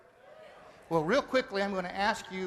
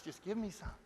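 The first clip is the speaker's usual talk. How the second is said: softer, flat repeat of the plea; quiet echo; 8 dB quieter than usual. Retold or imitated. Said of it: "this lady over here going".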